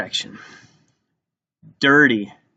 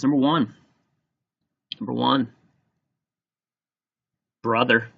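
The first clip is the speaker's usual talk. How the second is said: usual speaking voice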